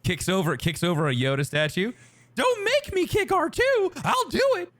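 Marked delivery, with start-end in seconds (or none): 2.3-4.7 slow, very high cartoon falsetto threat; Mickey Mouse. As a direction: menace someone in a squeaky mouse voice. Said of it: "he shakes him up".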